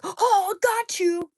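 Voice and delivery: very high, loud mock shout of gotcha; shrill mock triumph. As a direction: jeer like a gleeful accuser. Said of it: "they'll be like".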